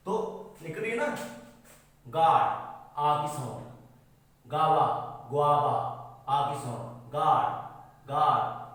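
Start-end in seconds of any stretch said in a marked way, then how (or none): 2.1-8.6 गार्ड and गावा shouted with a long "aa"; teacher emphasising the aa sound; loud vowel drill. Shown as a demonstration. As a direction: hammer the "aa" in गार्ड. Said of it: "आ रही है ना आ की sound".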